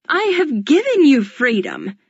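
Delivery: high, loud indignant cry; shrieking. A narrator's reading. The Duchess shrieks at the defiant surrogate. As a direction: shriek it back at her. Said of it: "she shrieks".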